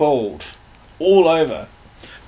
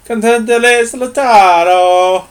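higher, louder, slow fake Italian; mock Italian Latin; 7 semitones higher, 8 dB louder, slower than usual. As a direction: ham up an Italian orator, slowly. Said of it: "Italian Latin".

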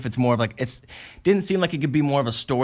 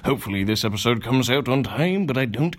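announcer outro; 7 semitones higher than usual. Acted. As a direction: hype it like a show host.

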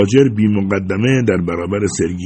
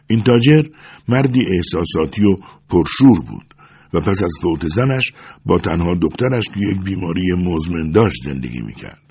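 ordinary delivery

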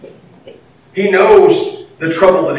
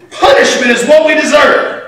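preached emphasis; 8 semitones higher, 4 dB louder than usual. Real, not acted. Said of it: "preaching emphasis".

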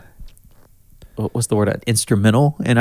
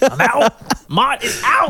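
much higher announcer call; sports announcer; 14 semitones higher, slower than usual. Pretend. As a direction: announce the exit. Mock announcer.

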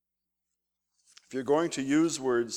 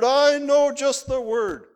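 thundered; 9 semitones higher, 10 dB louder than usual. Retold or imitated. Homesick missionary thanking retired lady missionaries, thundering with enthusiasm. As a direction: proclaim it like a big compliment. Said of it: "I thundered".

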